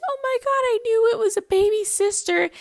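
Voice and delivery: put-on high, squealing child's delight; imitating excited daughter. Daughter crying with joy over her baby sister, high excited squeal. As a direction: squeal it like a thrilled little girl. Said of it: "she was crying, she's like".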